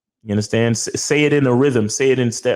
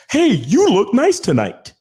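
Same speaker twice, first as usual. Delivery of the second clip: high-pitched, drawn-out mock compliment; generic compliment; 10 semitones higher, slower than usual. Man mimicking a bland compliment to his date, high and slow. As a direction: parody a stock date-night compliment, pitched up. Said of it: "It's not just".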